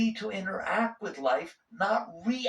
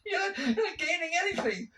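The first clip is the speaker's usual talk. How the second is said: high voice breaking into laughter; laughing exasperation; 12 semitones higher than usual. Real, not acted. Teacher tells students fighting is futile, laughing. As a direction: laugh through the words, much higher.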